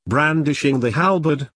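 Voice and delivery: ordinary delivery, nothing marked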